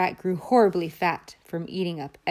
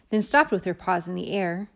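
ordinary delivery

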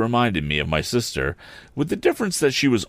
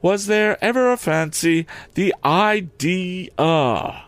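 higher, drawn-out indignant lady; 11 semitones higher, much slower than usual. Acted. Camilla indignant at Pip, higher with a very slow "The idea!". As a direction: stretch "The idea!" out in outrage. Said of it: "add with indignation".

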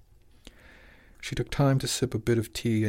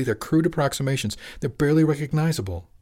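higher-pitched, indignant protest; heated; 6 semitones higher than usual. A narrator's reading. Father disputing his son's carvings, heatedly. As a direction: argue it heatedly, voice rising. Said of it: "he said heatedly".

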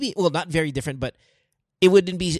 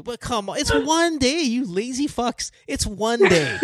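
exasperated scolding; 9 semitones higher, slower than usual. Real, not acted. Scolding friends, raised voice.

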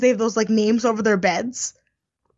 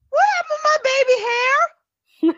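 very high, squeaky imitation; mock shocked squeal. Imitating a contestant shocked about baby hair, high squeal. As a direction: shoot way up into an incredulous squeal.

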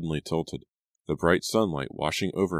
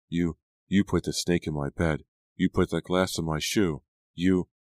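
ordinary delivery, nothing marked

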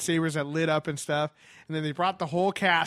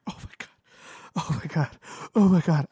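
hammed-up tearful fan, starting hushed, building louder; crying; 3 dB louder, faster than usual. Fan moved by Mark Hamill's speech, mock crying. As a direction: imitate being overcome, each repeat stronger. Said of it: "I'm, like, crying".

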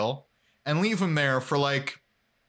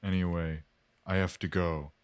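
lightly voiced guest character; lowered put-on voice; 5 semitones lower than usual. Mike voice signing off.

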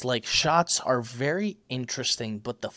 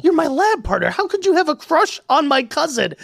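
high, loud mock whine of a kid; hammed-up childish protest, pitched way up; upset kid; 13 semitones higher, 4 dB louder than usual. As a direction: imitate a kid's heartfelt outburst. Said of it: "it's those feelings as a kid".

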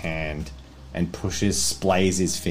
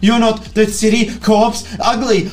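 loud, high, frantic begging; panicked pleading; 13 semitones higher, 8 dB louder than usual. Acted. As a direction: beg for your life, voice up high. Terrified Vinod begs Ugly.

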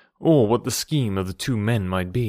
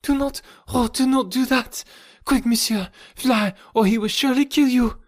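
terrified woman; 13 semitones higher than usual. Acted. Terrified woman pleading, warning Tarzan.

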